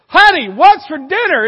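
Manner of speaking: loud, high husband shouting in her ear; acted shout. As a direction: shout it as if she were hard of hearing. Husband inches from her ear, escalating.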